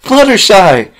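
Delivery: high, loud squeal of joy; excited relieved squeal. Acted. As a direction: squeal her name in sheer relief. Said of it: "in an excited and relieved squeal".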